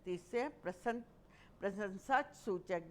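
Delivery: ordinary talk, nothing marked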